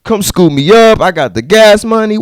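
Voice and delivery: light hammed-up hey; mock call out. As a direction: call out to a friend. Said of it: "could have just been like".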